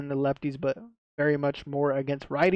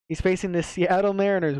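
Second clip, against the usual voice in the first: ordinary delivery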